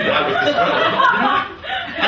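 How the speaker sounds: high laughing squeal, slowed on disrobed. Real, not acted. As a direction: break into laughter while admitting it.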